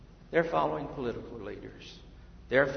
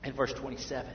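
ordinary talk

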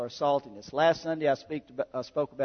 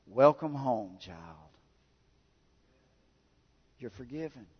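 tender welcome; 6 semitones lower, 10 dB quieter than usual. Pretend. God's tender answer, voiced.

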